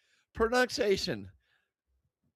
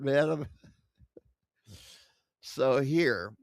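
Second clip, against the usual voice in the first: usual speaking voice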